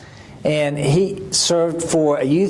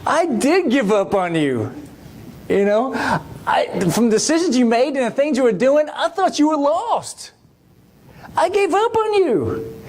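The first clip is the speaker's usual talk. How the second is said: retold tearful confession; 6 semitones higher than usual. Old minister admitting to the young woman, tearful. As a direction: voice climbing and breaking on the last words. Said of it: "And he's crying. And he says".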